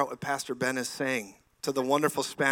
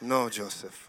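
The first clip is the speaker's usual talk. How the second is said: laughing; 3 semitones lower, slower than usual. Retold or imitated. Retelling interpreter scene, laughing.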